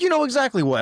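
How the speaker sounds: high, loud mock-indignant retort; acted accusation. Acted quarrel, accusing a friend.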